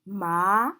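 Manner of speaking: upward-sliding "ma"; second tone. Shown as a demonstration. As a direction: lift "ma" like a question. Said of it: "when we indicate the four tones".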